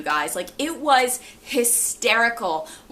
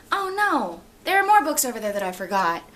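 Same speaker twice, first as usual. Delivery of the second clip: sudden realization. Real, not acted. Spots forgotten books mid-haul.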